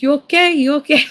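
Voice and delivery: high, loud concerned driver asking twice; worried Uber driver. Retold or imitated. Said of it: "he was like".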